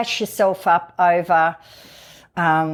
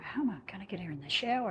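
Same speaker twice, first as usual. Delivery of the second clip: muttered worry; 16 dB quieter, faster than usual. Retold or imitated. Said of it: "I'm like".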